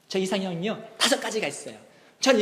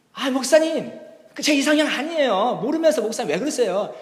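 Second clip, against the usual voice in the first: higher, louder whiny protest; annoyed refusal; 6 semitones higher, 5 dB louder than usual. Retold or imitated. Sister protesting to the pastor, annoyed whine. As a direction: brush the pastor off.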